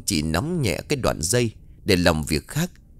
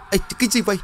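much higher, startled yelp; pained scream; 11 semitones higher, much faster than usual. A narrator's reading. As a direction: cry out in sudden shock and pain. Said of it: "một giọng thét lên muốn chết đi sống lại". (thét lên đau điếng)

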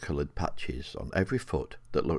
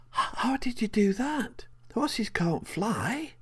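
put-on high, slowing, wide-eyed little girl; amazed child; slower than usual. A narrator's reading. Rowan, amazed, tells the horse that horses can't fly. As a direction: marvel at it like a small child.

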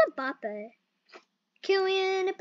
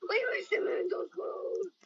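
whispering; 2 semitones higher, 9 dB quieter than usual. Real, not acted. Playful hushed aside while playing.

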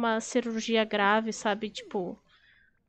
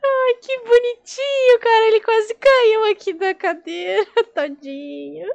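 laughing fond cooing; 9 semitones higher, 9 dB louder than usual. Real, not acted. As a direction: gush over a clumsy pet, half laughing. Derrete-se pelo gato que quase caiu. (voz aguda e derretida, rindo de ternura)

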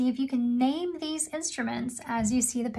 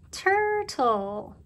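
"Turtle" stretched higher into tur-tle; syllable by syllable; 5 semitones higher than usual. Shown as a demonstration. Teacher asks how many syllables you hear, "Turtle" drawn out in parts. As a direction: draw out both halves of "Turtle". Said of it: "How many syllables do you hear?".

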